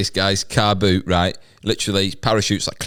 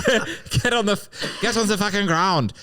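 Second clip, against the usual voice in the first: much higher, barked German soldier order; German paratrooper ordering the seller down; mock Nazi command; 11 semitones higher than usual. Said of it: "You did many accents there".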